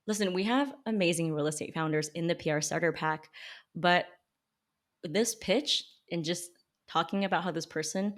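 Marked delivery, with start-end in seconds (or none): none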